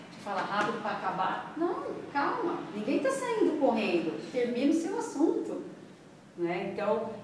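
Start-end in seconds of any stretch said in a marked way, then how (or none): 1.6-5.6 high-pitched, insistent telling-off; animated "calm down" appeal; mock exhortation. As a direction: urge a rushed speaker to calm down, pitch raised. Coaching an imagined rushed speaker.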